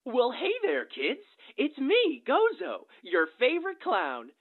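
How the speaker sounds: put-on high, bouncy children's entertainer; clown voice. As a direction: greet the kids like a party clown. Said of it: "I just showed you my clown voice".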